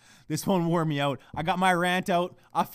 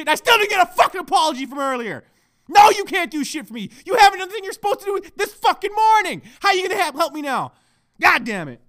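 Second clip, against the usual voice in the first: high, loud angry tirade; screaming rant; 9 semitones higher, 7 dB louder than usual. Real, not acted. Podcaster railing at his fiancée beside him, screaming rant. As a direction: let the grievance rip at full volume. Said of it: "I'm done screaming".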